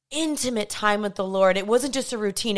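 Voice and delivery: usual speaking voice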